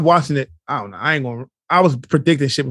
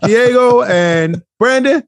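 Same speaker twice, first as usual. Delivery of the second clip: podcast host outro; 3 semitones higher, 4 dB louder, slower than usual. Shown as a demonstration. Signing off the show to listeners, announcer voice. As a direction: announce the hosts and handles with flair. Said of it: "close this out".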